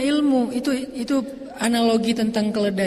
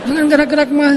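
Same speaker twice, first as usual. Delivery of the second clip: lightly voiced little son, higher and louder; imitating his child; 5 semitones higher, 6 dB louder than usual. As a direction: mimic a kid telling mum off, pitched up. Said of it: "Anak saya yang lucu".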